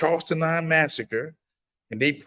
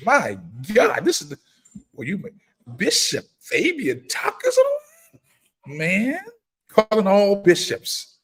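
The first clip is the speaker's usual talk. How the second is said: much higher, slow, stretched surprise; delighted surprise; 8 semitones higher, 4 dB louder, slower than usual. Real, not acted. Host seeing Bishop Fabian Tucker join the live, delighted surprise. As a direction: draw out the name in happy disbelief. Another bishop joins the stream.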